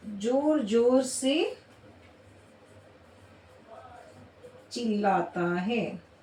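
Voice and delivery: slow classroom dictation. Shown as a demonstration. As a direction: give each word time so students can write it. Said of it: "एक ये शब्द है".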